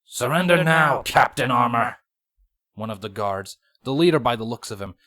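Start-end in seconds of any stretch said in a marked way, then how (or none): 0.0-2.0 loud, hissing order to surrender; hissed command. A narrator's reading. Changeling leader threatens the captured captain, loud hissing order. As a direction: bark the order as a hissing bug soldier.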